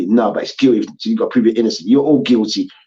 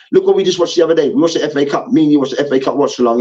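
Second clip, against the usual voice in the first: ordinary delivery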